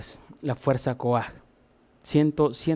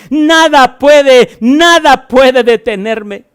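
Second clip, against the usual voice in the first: booming, slow, defiant declaration, much higher and louder; defiant shout; 10 semitones higher, 12 dB louder, slower than usual. Acted. Preacher proclaiming to the congregation, defiant booming shout. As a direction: proclaim it as if nothing on earth could stop you.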